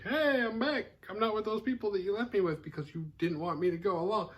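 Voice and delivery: a lightly voiced, high, overly casual hello; mock-breezy greeting. Mike strolling back in to his brother, high and breezy. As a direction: play it innocent, like nothing happened.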